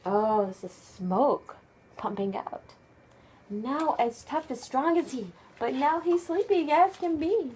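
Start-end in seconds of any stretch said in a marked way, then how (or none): none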